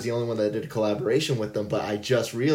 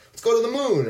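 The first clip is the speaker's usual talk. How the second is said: excited quote; 12 semitones higher, 4 dB louder, faster than usual. Retold or imitated. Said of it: "Tom Sachs is just kinda like".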